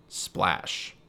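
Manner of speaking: ordinary delivery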